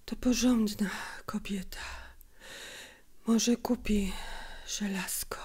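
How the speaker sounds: weak whisper with long breaks; a sick mother short of breath, softly; tired, broken voice. A narrator's reading. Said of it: "zmęczonym, przerywanym głosem".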